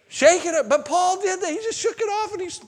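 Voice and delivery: building excitement; excited preaching. Acted. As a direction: lift them up with it.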